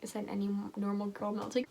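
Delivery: ordinary delivery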